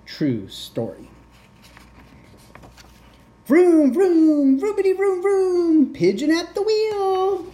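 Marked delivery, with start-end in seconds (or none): 3.5-7.5 very high, loud engine vrooms; gleeful pretend driving; pigeon vroom noises.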